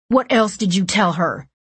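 angry. A narrator's reading. Furious at brother.

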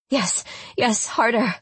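high, breathless begging. A narrator's reading. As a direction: plead with rising passion. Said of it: "I begged".

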